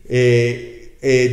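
ordinary talk

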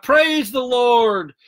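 loud, high, drawn-out praise shout; joyful shout. A narrator's reading. Woman on porch shouts for atheist neighbor's benefit, loud praise shout. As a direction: shout it slowly across to the neighbors.

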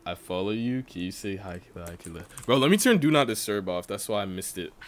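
normal talking voice

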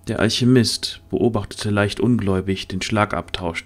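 usual speaking voice

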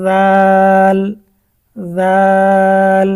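জাল stretched slowly and loud; long drawn জাল. Shown as a demonstration. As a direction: hold জাল for three beats. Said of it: "তিন আলিফ করে টান।". (টেনে জোরে জাল)

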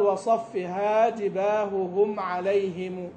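"جباههم" slow, both ه sounded separately; clear double ha. Shown as a demonstration. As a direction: sound each ه in "جباههم" apart. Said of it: "وصف الهاء". (إظهار الهاءين)

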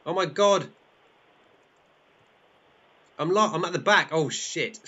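high, loud race panic; dismayed exclamation. Pretend. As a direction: wail playfully at falling behind. Host drops to last place.

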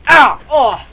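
loud, high yelp of pain; mock pained yelp. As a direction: yelp as if just slapped. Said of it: "make a slap sound".

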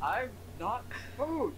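high, drawn-out mock outrage; indignant protest. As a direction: protest slowly in a high put-on voice. Character protests to the cat.

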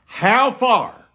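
ordinary delivery

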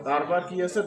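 ordinary talk, nothing marked

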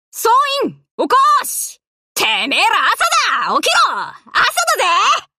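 high, loud rallying yell; wake-up shout. Acted. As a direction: roar them all out of bed. Waking the whole fleet, shouting.

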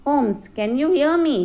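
calling out. A narrator's reading. Visitor calling to Holmes on entering the house, calling out. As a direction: call out lightly to see who hears. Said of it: "I heard a voice say".

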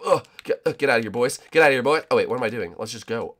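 ordinary talk

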